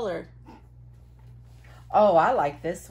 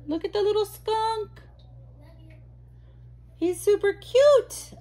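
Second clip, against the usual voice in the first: much higher cutesy voice; cooing over cute; 11 semitones higher, 3 dB louder than usual. Real, not acted. Showing viewers a skunk gloss, high cute coo.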